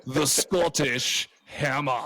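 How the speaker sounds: slow Scottish attempt drifting toward Schwarzenegger; bad Scottish accent, each word drawn out. Introducing the hammer on tape, botched Scottish accent. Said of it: "intro with my Scottish accent".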